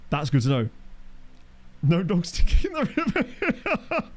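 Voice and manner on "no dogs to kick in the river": high, slow put-on voice; silly high voice for a pun; mock voice.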